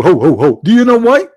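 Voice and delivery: ordinary talk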